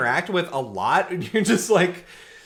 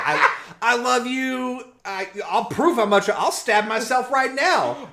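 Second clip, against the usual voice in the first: hammed-up desperate pleading; 6 semitones higher than usual. Boyfriend pleads with angry girlfriend, mock desperate. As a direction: imitate a frantic lover's vow.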